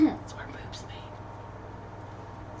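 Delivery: whispering it under the breath; breathy whisper, no voice. Real, not acted. A joke answer said unvoiced.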